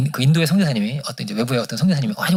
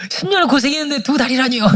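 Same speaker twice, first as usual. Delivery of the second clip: high, whiny protest to God; mock complaint; 10 semitones higher than usual. Preacher voicing a complaining missionary, high mock whine.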